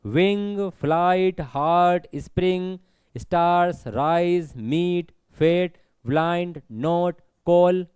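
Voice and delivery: each word from wing to my separate and unbroken; measured word-by-word list, wing through my; one beat each. Shown as a demonstration. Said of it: "एक ही बार में उच्चारण हो जाता है".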